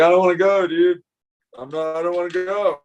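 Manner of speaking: put-on high, loud whimpering kid voice; high pleading boy about to cry; whiny crying kid. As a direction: imitate the son whining on the verge of tears. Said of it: "And he, like, starts crying".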